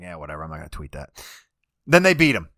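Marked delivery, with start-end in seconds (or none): 0.0-1.1 muttered low and much softer, shrugging it off; talking himself out of tweeting, softly dismissive; dismissive inner voice. Retold or imitated. To himself about not tweeting, a soft dismissive mumble. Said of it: "I'm like".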